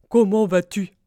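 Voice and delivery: very loud storybook badger; badger calling out. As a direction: boom a friendly question. Blaireau crie sa salutation. (question lancée d'une grosse voix de blaireau)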